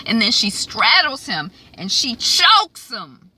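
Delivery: ordinary delivery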